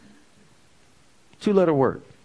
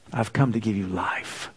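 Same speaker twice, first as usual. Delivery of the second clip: 1 soft on life; 5 semitones lower, 5 dB quieter than usual. Shown as a demonstration. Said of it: "You see where the emphasis is?".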